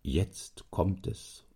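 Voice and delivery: slow, soft inner thought; softly muttered suspicion. Acted. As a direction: think it quietly to yourself, drawn out. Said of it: "dachte der Herr Preis".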